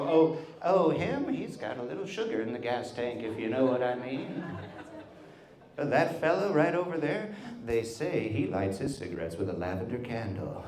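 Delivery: lightly voiced old-timer gossiping; hint delicately, like a discreet old-timer; older generation's euphemizing. Grandmother's generation gossiping about a man, lightly imitated. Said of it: "That's how they would say it".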